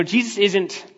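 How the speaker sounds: ordinary talk, nothing marked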